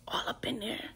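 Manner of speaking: hushed, secretive whisper; breathy girl-talk whisper; whispered aside. Retold or imitated. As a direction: lean in and whisper it like gossip. Gossiping about the ultrasound exam.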